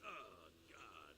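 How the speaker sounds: very low, hushed mock ecstasy; mock lustful moan. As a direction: drop way down for a hammed-up lover's murmur. Retold lover's groan, repeated gag.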